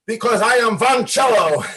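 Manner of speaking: loud, high defiant shout; shouting his new name in anger; angry declaration. Retold or imitated. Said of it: "but I got angry".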